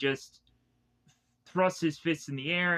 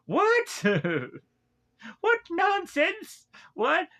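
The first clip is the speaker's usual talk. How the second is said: very high, far higher incredulous "What?"; incredulous squeak; 3 dB louder, slower than usual. Real, not acted. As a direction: squeak it out, laughing in disbelief. Mocking Mikasa's nonsense.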